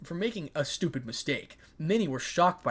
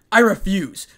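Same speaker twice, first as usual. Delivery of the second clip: loud, higher cold refusal; cold, emphatic raised voice; 7 semitones higher, 15 dB louder than usual. A narrator's reading. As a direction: refuse forcefully and coldly. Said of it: "responded in a cold manner".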